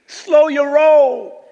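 high, loud, stretched-out words; drawn-out call. Acted. Preacher calling the church to rest, drawn-out call. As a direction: holler it slowly, holding the last word. Slogan called out to congregation.

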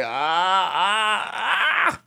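rising strained whimpers; straining whimpers. Retold or imitated. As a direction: whine like you can't reach the phone. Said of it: "I'm like".

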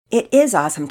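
lightly acted boyish enthusiasm, loud; enthusiastic agreement. A narrator's reading. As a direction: agree eagerly and loudly with a friend. Boy agrees with his friend.

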